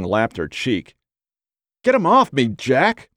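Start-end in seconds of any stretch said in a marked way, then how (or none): 1.8-3.1 high, loud cry for help; shrieking at Jack from the bed; panicked shout. Acted. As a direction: yell it out, startled and disgusted. Dog jumps on her in bed.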